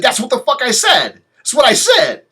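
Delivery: high and loud, fed up; mock outraged shout; exasperated retort. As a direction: snap it back in frustration.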